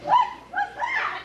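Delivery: high, slow alarmed question; startled by a crash. Acted. Mother startled by the crash in the dark, alarmed.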